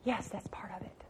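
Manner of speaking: soft whisper, an aside to the room; hushed concession. Real, not acted. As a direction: drop to a whisper.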